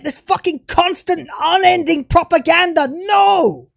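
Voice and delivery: loud, shouted rant that builds; screaming at the showrunner in rage; furious shouting. Real, not acted. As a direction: yell it out, fed up and furious. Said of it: "This angry rant".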